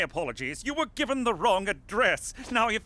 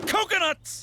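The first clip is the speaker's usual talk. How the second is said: high, punchy exclamation; excited outburst; 9 semitones higher than usual. Acted. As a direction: blurt it out with sudden glee. Same word repeated with emphasis.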